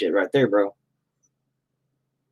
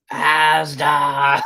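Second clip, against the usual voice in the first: ASDA stretched out in a higher mock English voice; mock English accent; 5 semitones higher than usual. Mocking English viewers' supermarket name, ASDA in exaggerated English accent. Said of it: "Y'all talking with weird accents".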